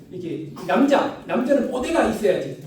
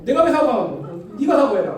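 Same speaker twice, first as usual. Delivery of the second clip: louder Gyeongsang schoolkid squabble; Gyeongsang bickering; 5 dB louder than usual. Retold or imitated. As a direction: argue back in Gyeongsang dialect. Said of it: "짧은 뭐 사투리지만".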